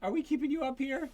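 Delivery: high, teasing questions; playful teasing. Real, not acted. Parent teasing a yawning child during practice, high playful tease.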